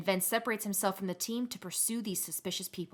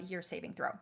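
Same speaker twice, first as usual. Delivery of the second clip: usual speaking voice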